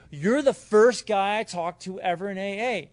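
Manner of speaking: loud and slow, emphatic; excited. Retold or imitated. Grateful newcomer, emphatic.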